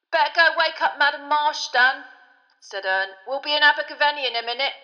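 put-on old driver calling to Stan over the bus; Ernie the driver.

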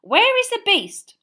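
slow, high, loud reedy bark; reedy demand. A narrator's reading. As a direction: demand an answer, baffled. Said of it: "said the reedy voice".

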